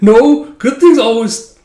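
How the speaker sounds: high, loud cry of no; loud protest. Acted. Girl cries out against his fear, loud and high. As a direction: she cries out to stop him.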